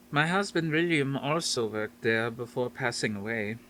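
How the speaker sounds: a hint of a Dutch grandmother; faint Dutch accent. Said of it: "her faint Dutch accent".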